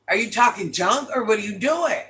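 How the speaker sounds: ordinary talk, nothing marked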